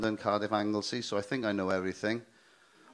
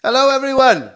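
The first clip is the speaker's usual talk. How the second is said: bellowed high hello to the crowd; loud greeting; 14 semitones higher, 17 dB louder than usual. Real, not acted. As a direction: boom out the greeting. Comedian greets crowd loudly.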